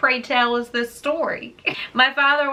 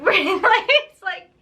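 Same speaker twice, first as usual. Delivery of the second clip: laughing; 8 semitones higher, 3 dB louder than usual. Real, not acted. Laughing before women chase truck.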